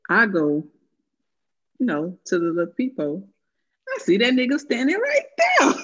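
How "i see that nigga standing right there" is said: high, louder storytelling disbelief; shocked reveal. Retold or imitated. Telling friend who was at the peephole, shocked reveal. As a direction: let the pitch leap in alarm at the reveal.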